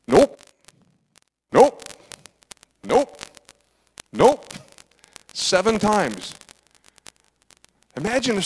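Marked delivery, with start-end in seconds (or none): 0.1-4.5 higher, louder rising nopes, one after another; a run of rising, playful refusals; repeated divine nopes. Retold or imitated. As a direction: brush each son off quickly. God rejecting each brother.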